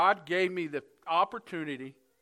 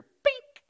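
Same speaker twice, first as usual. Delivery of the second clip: sudden high sharp "bink"; bright high metallic ping; imitated clip bink; 7 dB louder than usual. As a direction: pop the word out like a clip snapping loose. Clip snapping off curtain.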